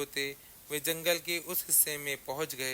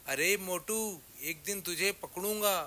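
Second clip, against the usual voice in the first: raised, higher-pitched threat; farmer's shout; 5 semitones higher than usual. A narrator's reading. Farmer yells after the fleeing goat, threatening shout. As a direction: call it after someone running away. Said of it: "किसान पीछे से चिल्लाया".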